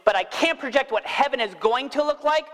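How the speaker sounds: ordinary talk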